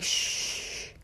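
soft unvoiced shh to silence a voice; finger-to-lips shush. Shown as a demonstration. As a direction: hush it like quieting a child. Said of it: "Be like".

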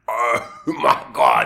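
slow, loud laughing "oh my God"; reliving the shock of seeing the shark; awed gasp. Retold or imitated. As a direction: blurt it out, stunned and laughing. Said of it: "and I just went".

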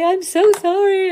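high-pitched imitation of a weepy sister; hammed-up sobbing apology, pitched high; mock tearful apology. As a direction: squeak out a teary sorry. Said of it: "she was like".

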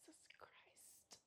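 slow unvoiced whisper; whispered oath. Girl realising mom is home, whispered panic. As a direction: breathe it out in dread.

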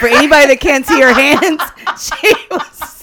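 loud, high, cracking up; helpless laughter breaking into the words; laughing. Real, not acted. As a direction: shout it through real laughter. Cracking up describing a gesture.

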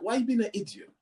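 high-pitched exasperated mum; scolding mum. Retold or imitated. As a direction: imitate a mother telling off her son. Mum scolding him, retold.

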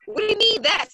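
high, loud indignant demand; young sailor confronting the chief, outraged high shout; outraged retort. Retold or imitated.

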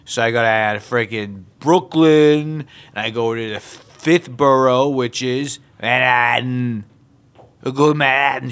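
rambling mock New Yorker, place names stretched; New York accent. Documentary subject on his boroughs, exaggerated New York accent. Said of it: "New York accents".

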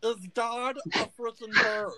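a little gnome spiralling into a crisis; panicky gnome Stitch. Acted. Gnome Stitch freaks out that a stranger guessed his name.

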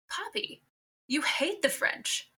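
high-pitched, drawn-out mock incredulity; retold disbelief. Grandchild to grandfather planning a move to France, mock disbelief.

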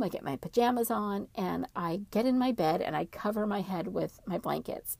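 plain reading voice

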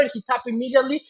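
ordinary talk

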